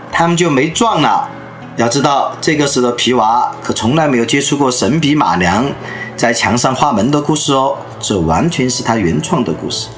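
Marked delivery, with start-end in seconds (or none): none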